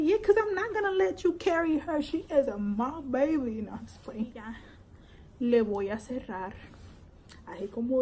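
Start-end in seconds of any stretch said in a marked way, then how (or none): 0.0-3.6 playful possessive. Pretend. Teasing husband retold.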